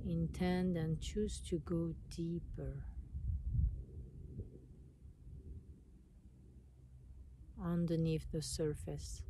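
calm meditation voice with long pauses; guided meditation. Acted. Guiding listeners to relax and notice their breath, meditation voice. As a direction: guide them inward, unhurried, leaving space between phrases.

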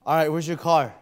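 grudgingly giving in; annoyed helper; with attitude. Retold or imitated. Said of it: "like that attitude".

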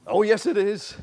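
loud, high panto insistence; playful, projected counter-claim; pantomime comeback. Acted. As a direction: answer back like a panto audience. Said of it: "it's almost pantomime".

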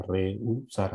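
"rehusar" despacio, partido en re, u, sar; sílabas separadas. Shown as a demonstration. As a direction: separa las vocales de "rehusar". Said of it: "¿así sería?". ("rehusar" lento, sílaba a sílaba)

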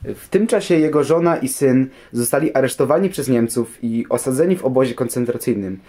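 normal talking voice

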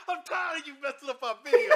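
high, loud fed-up yell; irritated shout. Real, not acted. Host yelling at his friend for ruining videos, loud. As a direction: raise it up, exasperated. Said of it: "You tired?".

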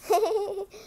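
amused giggle; short laugh. Real, not acted. Reader laughing at the twister, giggle. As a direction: laugh at the tangle.

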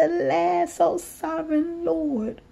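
pleading cry. Retold or imitated. Ezekiel face down begging God to spare Israel, pleading. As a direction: cry out to the Lord for mercy. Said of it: "and he cried out".

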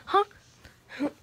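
ordinary delivery

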